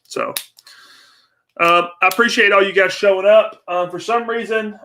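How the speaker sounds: normal talking voice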